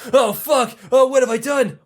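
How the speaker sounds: a king realising he is doomed; mock dread, voice up and loud; panicking king. King panics about prophecy.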